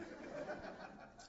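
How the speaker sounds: breathy laugh at his own joke. Real, not acted. Preacher laughing at his own punchline, breathy. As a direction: let the laugh escape.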